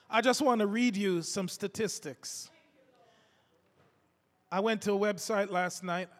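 ordinary delivery

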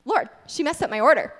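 mock-indignant excuse; protesting. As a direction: plead your case to the Lord, indignant. Whining excuse to the Lord.

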